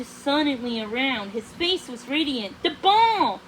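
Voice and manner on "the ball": louder, higher sudden exclaim; blurted out. A narrator's reading. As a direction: burst out with the idea. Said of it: "he blurted out".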